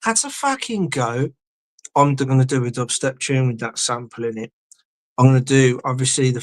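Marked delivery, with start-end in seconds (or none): 0.1-1.3 high-pitched imitation of a little girl; put-on little-girl voice; little girl impression. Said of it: "the little girl that goes".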